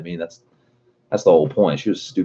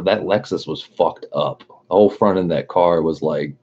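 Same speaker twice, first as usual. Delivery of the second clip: normal talking voice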